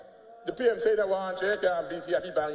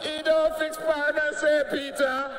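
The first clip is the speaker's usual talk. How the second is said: emphatic shout; 4 semitones higher, slower than usual. Real, not acted. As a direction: shout the accusation at the opposition. Repeating the charge for emphasis.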